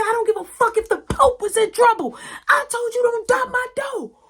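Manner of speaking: high furious shout; furious mom. Retold or imitated. Mom screaming at her son about the door. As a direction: scream it at him, fed up.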